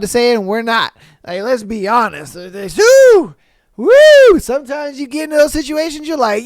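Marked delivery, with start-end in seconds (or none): 3.8-4.4 high, loud whooping cheer; hyped-up woo; excited woo yell. Real, not acted. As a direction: let out a big whoop. Hype yell after joke.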